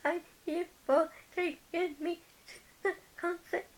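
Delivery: high, loud imitated sobbing thanks; mock hysterical crying. Young fan thanking her sister after the concert, sobbing high and loud. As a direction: replay her own teary gratitude, sobbing and squeaky. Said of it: "crying hysterically".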